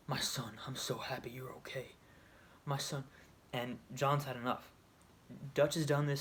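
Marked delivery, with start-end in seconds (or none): 0.1-3.0 soft fatherly whisper. Retold or imitated. Dutch soothing John after betrayal.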